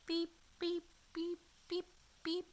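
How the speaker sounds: high, clipped electronic beeps; scanner beeps. Acted. Moon men's scanners beeping at the old woman, high robotic beeps. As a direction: beep like a little handheld scanner.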